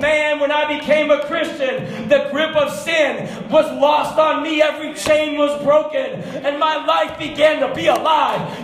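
high, loud pulpit proclamation, stretching key words; preaching at full force. Acted.

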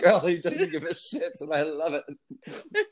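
chuckling through the words; laughing. Real, not acted. Guest reframing his story while laughing. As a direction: laugh as you take it back.

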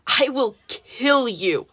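slow, drawn-out threat at the guards; a boy's slow, furious vow; yelled threat. Acted. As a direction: spit each word slowly at the guards. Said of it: "Then he yelled at the guards".

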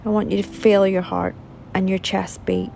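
calm, measured meditation guide with long pauses; soothing relaxation-exercise voice, phrase by phrase; guided meditation. Acted. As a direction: unhurriedly guide them through a body scan, leaving space to breathe. Leads a guided body scan meditation.

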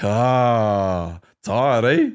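sing-song tired eh, rising at the end; mocking taunt. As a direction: needle him about being tired. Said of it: "and I'm like".

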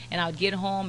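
normal talking voice

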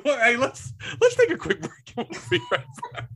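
high, squeaky talk through laughter; cracking up. Real, not acted. Host calling a break mid-fit, laughing hard. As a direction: push the words out while helplessly laughing.

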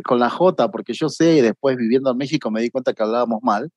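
ordinary delivery

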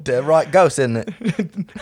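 high, loud hammed-up British; mock British accent. Shown as a demonstration. Friend has a go at the British accent, hammed-up. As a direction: put on British, half laughing. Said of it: "British accent".

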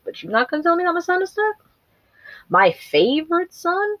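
high, fast, indignant outburst; outraged grieving father. Retold or imitated. Denethor confronting Gandalf about his dead son, high and indignant. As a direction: spit the accusation out at Gandalf, voice cracking up high. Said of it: "didn't tell him that".

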